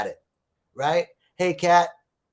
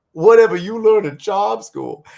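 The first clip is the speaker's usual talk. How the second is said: loud, slow, higher put-on lady voice; mock snooty exclaim; 6 semitones higher, 10 dB louder than usual. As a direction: ham up a condescending Southern lady. Rich women mocking the poor one.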